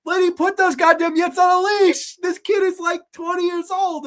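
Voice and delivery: high, loud comic rant; exasperated scolding. Acted. Reviewer telling off the lady on screen, high exasperated yelling. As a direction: yell at her in mock outrage. Scolds the character in mock outrage.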